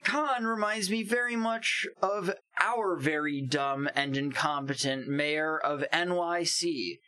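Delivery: mock-presidential read-out with swooping pitch; dramatic tweet reading. Podcaster reading favorite Trump tweets aloud, theatrical declaiming.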